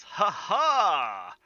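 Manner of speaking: loud, high two-beat "ha ha"; a showy stage laugh, drawn out on the last ha; triumphant entrance laugh. Acted. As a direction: announce yourself with a big laugh. Yolo's grand entrance laugh.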